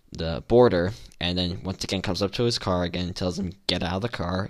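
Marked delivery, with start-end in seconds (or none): none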